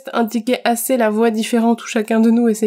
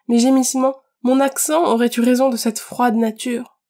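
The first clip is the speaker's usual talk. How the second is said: plain reading voice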